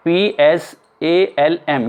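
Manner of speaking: ordinary talk, nothing marked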